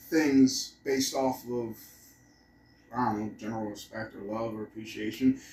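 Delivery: ordinary delivery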